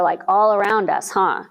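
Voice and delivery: normal talking voice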